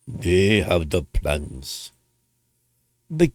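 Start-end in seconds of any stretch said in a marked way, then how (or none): 0.1-1.9 put-on very slow, deep, soft Japanese accent; hammed-up low Japanese accent, stretched out; bad Japanese accent. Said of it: "not very good at Japanese accents".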